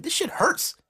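put-on high, puzzled young boy voice; confused boy. Boy confused about doing it right. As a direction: sound lost and a bit pained.

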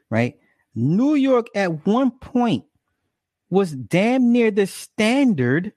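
slowed down, each phrase weighed and drawn out; real slow. Shown as a demonstration. Repeating his point real slow for the viewers. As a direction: spell it out slowly so it sinks in. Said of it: "Let me say that again real slow".

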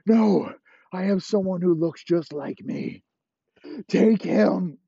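high, loud panicky refusal; high loud panicked pleading; panicked Elway. Acted. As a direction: scramble to save himself, high and loud. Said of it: "And Elway's".

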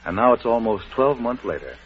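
ordinary delivery

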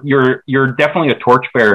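ordinary talk

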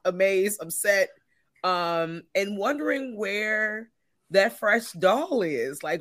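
usual speaking voice

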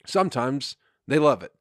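ordinary delivery

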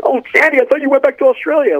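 very high mock movie-dialogue voice; acted film line. Guest acts out the Grease reply to Sandy, very high mock voice. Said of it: "and then, you know".

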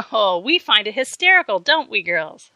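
teasing. A narrator's reading. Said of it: "she teased".

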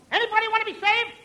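a boastful lifesaver hollering for customers; calling out. Acted. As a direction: shout to the swimmers. Calling out on beach.

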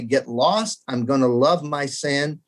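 usual speaking voice

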